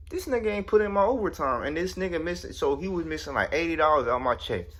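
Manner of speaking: high-pitched, worked-up grievance; indignant complaint. Retold or imitated. Worker finds overtime missing from check, high-pitched indignation. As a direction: voice climbs in outraged disbelief.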